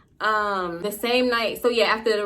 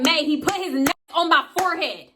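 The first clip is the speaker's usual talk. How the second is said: high-pitched, exasperated exclamation; indignant protest; 7 semitones higher than usual. Retold or imitated. Storyteller tells May what the dude did, indignant protest. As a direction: plead the outrage in a raised pitch.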